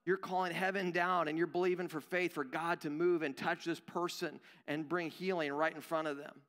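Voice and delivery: ordinary delivery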